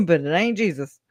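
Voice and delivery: ordinary delivery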